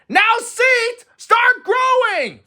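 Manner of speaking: high, loud, slow shout. A narrator's reading. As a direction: yell it out, stretching the words.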